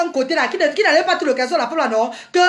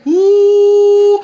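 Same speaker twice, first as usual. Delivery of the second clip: loud drawn-out booing ouh; mock booing; 3 semitones higher, 8 dB louder than usual. Leading the girls in booing irresponsible men, loud ouh. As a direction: boo them like a jeering crowd.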